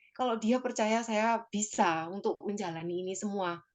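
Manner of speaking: ordinary talk, nothing marked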